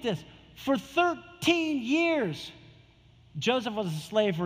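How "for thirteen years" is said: slow emphasis. Acted.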